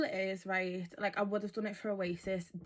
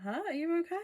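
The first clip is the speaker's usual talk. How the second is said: high, louder baffled huh; surprised disbelief; 10 semitones higher, 5 dB louder, faster than usual. Retold or imitated. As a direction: ask in amused shock. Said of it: "we were like".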